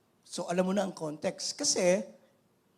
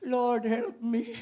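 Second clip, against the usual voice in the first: pleading; 3 semitones higher, 4 dB louder, much slower than usual. Retold or imitated. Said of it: "Sabi niya".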